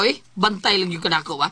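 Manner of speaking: usual speaking voice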